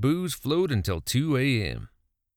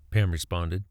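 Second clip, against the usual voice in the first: ordinary delivery, nothing marked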